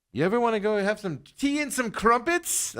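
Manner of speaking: high-pitched, badly faked London accent; terrible London accent. Host inviting someone for tea and crumpets, terrible London accent. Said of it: "that's a terrible London impression".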